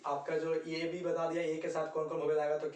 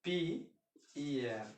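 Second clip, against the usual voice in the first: slow spelling; much slower than usual. Shown as a demonstration. Teacher spells the word slowly for learners. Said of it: "तो European".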